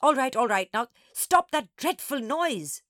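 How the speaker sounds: loud, stern order; high, forceful, impatient command; exasperated. A narrator's reading. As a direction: scold a wailing child to hush. Teacher exasperated at wailing child.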